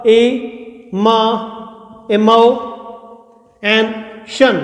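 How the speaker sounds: इमोशन taken apart slowly, e, mo, shan; broken into syllables. Shown as a demonstration. Teacher answering how it is said, syllables spaced out. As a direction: sound out इमोशन piece by piece with pauses. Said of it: "कैसे होगा?".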